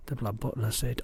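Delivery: plain reading voice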